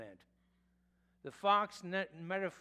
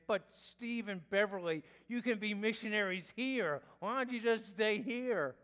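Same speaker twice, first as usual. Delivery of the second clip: mother pleading; 6 semitones higher, 7 dB louder than usual. Retold or imitated. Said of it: "My mother kept on saying".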